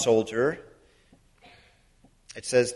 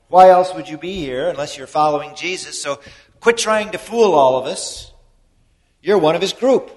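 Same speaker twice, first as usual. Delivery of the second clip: loud, rising, insistent charge; accusing bystanders; 4 semitones higher, 9 dB louder than usual. Retold or imitated. Bystanders confront Peter about his Galilean accent, loud and insistent. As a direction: stop fooling us, we know you.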